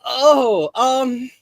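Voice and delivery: startled. Acted. Character startled.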